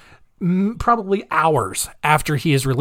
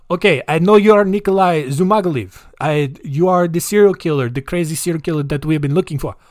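put-on Russian accent; 2 semitones higher than usual. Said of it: "combine Russian and Chinese".

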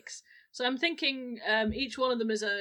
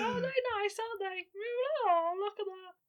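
ghost voice; 11 semitones higher than usual. Acted. Ghost coos at bird.

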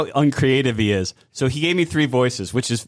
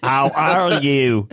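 mock Australian accent; much slower than usual. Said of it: "robot online Australian translator".

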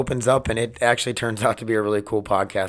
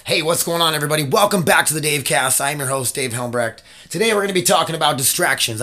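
brighter, projected intro read; podcast host voice; 4 semitones higher than usual. Shown as a demonstration. Host opening the episode, projected and clear. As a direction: open the show like a host. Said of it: "I try to practice doing that".

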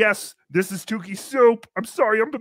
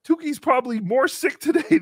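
ordinary talk, nothing marked

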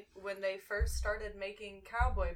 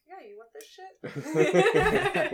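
slow, loud, higher taunt with a laugh; mock offer; 8 semitones higher, 9 dB louder, much slower than usual. Bartender offers a drunk customer the mat shot, mock-eager. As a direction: dangle it at them teasingly.

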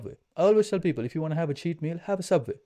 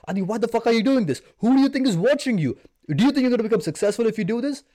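angry inner voice; 9 semitones higher, 6 dB louder than usual. Retold or imitated. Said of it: "I'm very angry. I'm like".

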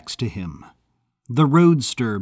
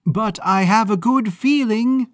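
high, brassy put-on agent voice; Maud mimicking a Hollywood agent; 10 semitones higher, 4 dB louder than usual. Maud fakes an agent to Fitzgerald at the gate. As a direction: play a slick Hollywood agent, pitched up. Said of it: "her best impression of Elizabeth's impression of a Hollywood agent".

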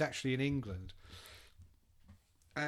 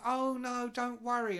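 mock band reassurance; 8 semitones higher than usual. Voicing Hawkwind band members.

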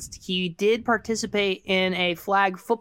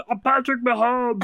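Patrick Mahomes impression; 4 semitones higher than usual. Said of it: "you got any football impressions?".

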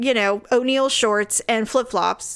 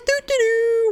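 high, singsong oblivious humming; mock someone strolling along without a clue; clueless wife; 12 semitones higher than usual. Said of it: "And she's clueless".

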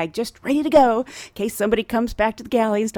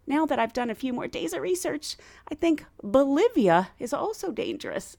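ordinary talk, nothing marked

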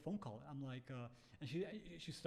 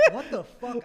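loud, high, drawn out through laughter; real laughing disbelief, stretched slowly; incredulous laughing outburst; 9 semitones higher, 14 dB louder, much slower than usual. Real, not acted. Both laughing at espionage joke.

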